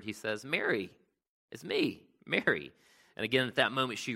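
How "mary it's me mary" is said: higher, gentle call of her name; a risen teacher calling Mary by name, joyful and tender; joyful recognition. Retold or imitated. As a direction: let her know it's you, tenderly. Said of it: "Jesus then just, again, in a word".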